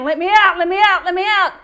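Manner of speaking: loud repeated plea to be let out; pleading call. Retold or imitated. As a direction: cry out like a caged lion begging release. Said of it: "and he's saying".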